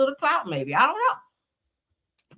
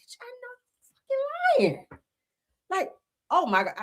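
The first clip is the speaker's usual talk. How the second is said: dramatic disbelief. Shown as a demonstration. Mocking her own dramatic reaction to gossip, squealing high. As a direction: overplay the shock for laughs. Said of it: "I'm so dramatic".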